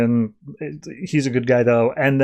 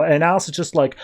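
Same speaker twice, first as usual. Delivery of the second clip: usual speaking voice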